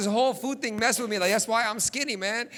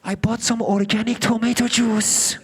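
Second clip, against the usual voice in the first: mom; 7 dB louder than usual. Retold or imitated. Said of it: "She's like".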